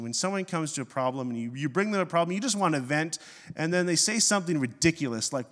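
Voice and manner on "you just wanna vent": usual speaking voice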